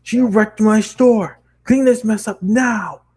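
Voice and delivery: loud, high, slow bellow; angry boss. Acted. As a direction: roar at the wrecked store. Boss shouting.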